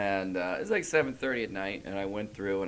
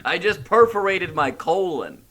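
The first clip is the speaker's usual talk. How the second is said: mock pained announcement; 5 semitones higher, 5 dB louder than usual. Acted comic injury announcement.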